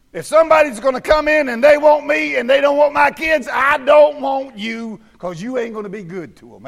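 high, loud revival preaching; preaching at full force. Acted. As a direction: bring it up and hammer the congregation. Preaching flares again.

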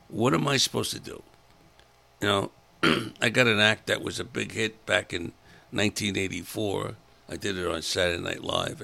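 ordinary talk

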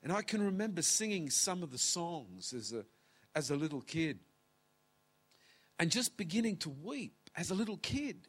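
usual speaking voice

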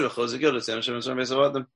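fast run-through of the verse; quick recitation. Shown as a demonstration. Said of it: "We just said".

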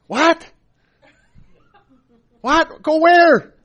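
high, loud incredulous questioning; baffled disbelief. Acted. Believer told only to go, baffled disbelief. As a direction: blurt it out, totally thrown. Acts baffled reaction to Jesus's answer.

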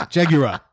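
"Jaguar" repeated as jag-yur-uh; imitated mispronunciation. Friend echoing the other's error, mimicked wrong pronunciation. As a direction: mimic "Jaguar" with a stray uh after the R. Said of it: "You're saying Jaguar".

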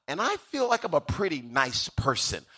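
ordinary delivery

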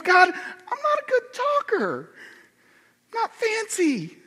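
high, drawn-out tearful whine; mock whining Moses. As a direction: whinge it slowly like a hammed-up sob. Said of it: "He goes".

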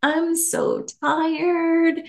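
imitated grand, lingering delivery; Oprah. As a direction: stretch each word out long and high. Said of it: "said it with this beautiful accent".

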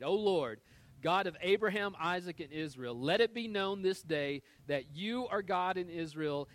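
loud, ringing prayer from the pulpit; fervent prayer reading. Acted. Preacher voicing Elijah's prayer to God, fervent and ringing. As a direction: proclaim the prayer with fire.

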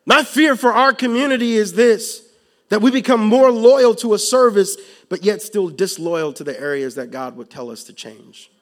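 loud, rising sermon delivery; preaching at full force. Acted. Preacher warning the congregation, loud and urgent. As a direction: press the fear home to the church.

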